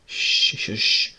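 hushed breathy "shh" to calm him. Acted. Soothing a crying boy.

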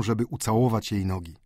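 plain reading voice

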